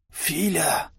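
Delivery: faint, hoarse plea; wheezing. A narrator's reading. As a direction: wheeze it out as if badly hurt. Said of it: "просипел наш лидер".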